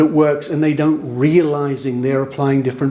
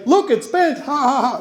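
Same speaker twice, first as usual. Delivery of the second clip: high, laughing mock gloat; 8 semitones higher than usual. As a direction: crow over the bent spoon with a laugh. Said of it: "There's always people who say".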